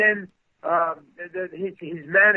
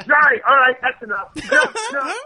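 retold angry cut-off, high and loud start; so mad; 9 semitones higher, 5 dB louder than usual. Larry ending the improv, mock angry outburst. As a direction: imitate someone snapping out of patience, pitched up. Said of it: "he just got so mad".

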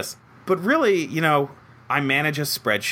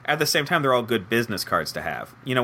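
ordinary delivery

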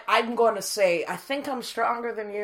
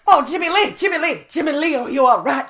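high, loud anxious call; worried calling; 8 semitones higher, 11 dB louder than usual. Acted. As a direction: rush to check on him. Friend has fallen.

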